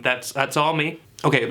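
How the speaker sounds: ordinary talk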